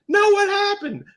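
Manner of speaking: put-on high, loud child's pleading; insistent little kid. Little boy nagging his mother, high and loud. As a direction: push back like a nagging small boy. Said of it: "I was just a little kid".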